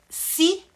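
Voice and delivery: one explosive SE, then pause; emphatic outburst. Real, not acted. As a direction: throw out a sudden, loud, high SE. Sudden emphatic if after pause.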